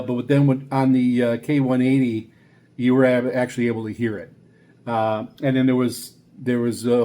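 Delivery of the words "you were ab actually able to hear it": normal talking voice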